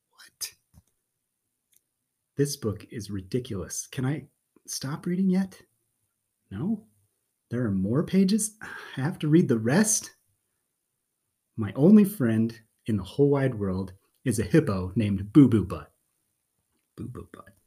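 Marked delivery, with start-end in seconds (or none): 0.1-0.5 baffled whispered what; a stunned little whisper. Acted. Shocked by the silly song. 17.0-17.5 Boo Boo Butt barely voiced and much softer; mortified under-the-breath repeat; embarrassed mutter. Mortified repeat of silly name.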